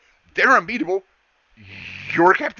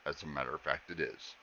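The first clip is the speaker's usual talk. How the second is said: much lower, much softer robot drone; 13 semitones lower, 16 dB quieter than usual. Acted. Max replies to her in a low, soft robot drone. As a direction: drone it low and quiet like a machine. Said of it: "And he goes".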